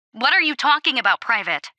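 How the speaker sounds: brisk officer's rebuke; sharp challenge. A narrator's reading. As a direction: demand an answer from the private. Squad leader challenges the private.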